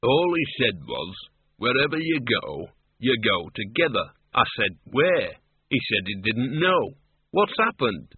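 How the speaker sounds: slow character voice retelling an encounter; story character recounting. Acted.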